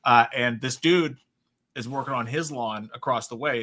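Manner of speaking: ordinary talk, nothing marked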